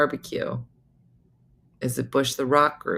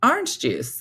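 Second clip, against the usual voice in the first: higher-pitched, imitating everyday phrase; matter-of-fact mimicry; 9 semitones higher than usual. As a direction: mimic them saying an everyday word. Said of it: "as if they're saying".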